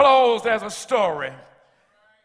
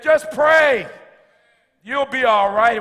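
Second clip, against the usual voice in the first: put-on firm, raised father's voice calling back to the boy; a father losing patience, calling louder and higher; father's stern call; 3 semitones higher, 5 dB louder than usual. Father in story answers the crying son.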